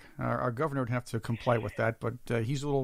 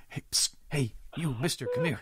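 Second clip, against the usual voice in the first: psst for attention. Retold or imitated. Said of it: "looks for passersby and says".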